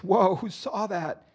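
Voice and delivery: high, slow exulting cry without bullhorn; crazed triumph. A narrator's reading. Alex gloats after being shoved, unamplified and high. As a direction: gloat that the shove was seen, pitched high. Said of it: "crazed triumph".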